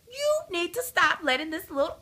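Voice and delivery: high-pitched mother's telling-off; exasperated, high parental lecture; retold exasperated scolding. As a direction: scold a child in a high, insistent voice. Mother scolding her son in retelling.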